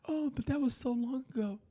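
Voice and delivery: sing-song sarcastic parody of an excuse; mocking Hillary excuse. Mocking the Clintons brushing off the past, high sarcastic whine. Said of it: "And be like".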